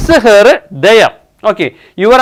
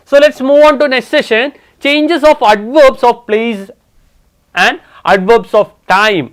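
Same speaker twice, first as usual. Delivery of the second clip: slow announcing; 4 semitones higher, much slower than usual. Shown as a demonstration. Teacher announces new session title.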